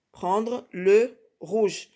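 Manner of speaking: PRENDRE, LE, ROUGE very slowly with gaps; slow, spaced repeat. Shown as a demonstration. Teacher telling pupils which colour to take, slow spaced repeat. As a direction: dictate "Prendre le rouge" word by word.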